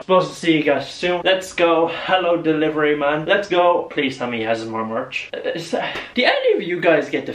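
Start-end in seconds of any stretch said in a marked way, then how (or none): none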